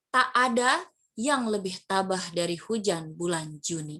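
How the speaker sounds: slow, with "Tak ada" stressed; slow poetic stress on "Tak ada"; emphatic poem reading. Shown as a demonstration. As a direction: read the line with weight on key words. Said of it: "ini ditekankan".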